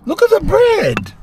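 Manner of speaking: very high, amazed squeal; thrilled at a croissant bun, very high squeal; squealing delight. Real, not acted. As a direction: squeal it like you can't believe the bread. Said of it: "repeated much higher".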